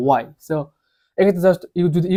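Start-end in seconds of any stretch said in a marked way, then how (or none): 0.1-0.2 "why" modelled alone without h; h-less model "why"; correct pronunciation. Shown as a demonstration. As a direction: say "why" as wai, w first. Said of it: "correct pronunciation হবে".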